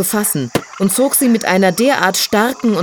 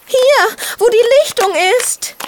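hopeful voice. Acted. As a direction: guide him in, high and hopeful. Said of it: "die hoffnungsvolle Stimme".

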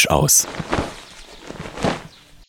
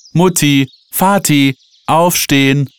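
high, held calls through the house; calling out; 10 semitones higher, 4 dB louder, slower than usual. A narrator's reading. Children waking their parents, calling out high. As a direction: holler to wake them, not full force. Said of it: "brüllten sie durchs Haus".

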